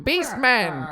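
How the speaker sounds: high, loud growled name; Beastman voice. Pretend. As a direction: snarl it like a cartoon henchman. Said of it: "you do Beastman".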